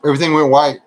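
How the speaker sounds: usual speaking voice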